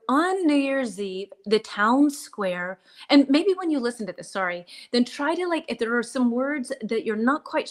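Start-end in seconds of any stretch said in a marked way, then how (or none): 0.1-2.8 slow, measured story read-aloud; slower reading. Shown as a demonstration. Teacher starts reading a story for learners, slowly. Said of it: "I will read a little louder and slower".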